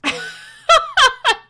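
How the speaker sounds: high loud cackle. Real, not acted. Streamer cackling at her ASMR joke.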